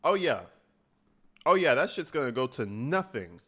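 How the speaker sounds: ordinary talk, nothing marked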